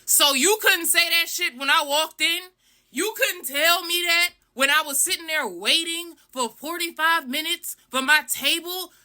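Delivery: acting out an affronted retort; indignant. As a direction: throw it back at them in disbelief. Indignant retort to restaurant staff.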